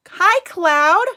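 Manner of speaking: high bright hello; cheerful greeting. Real, not acted. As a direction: greet them with delight.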